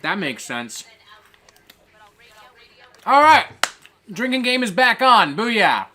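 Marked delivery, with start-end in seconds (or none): 3.0-5.9 loud, high triumphant announcement; rally everyone, loud and pitched up; pumped-up celebration; excited. Real, not acted. Celebrates drinking game resuming.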